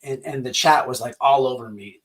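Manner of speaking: ordinary talk, nothing marked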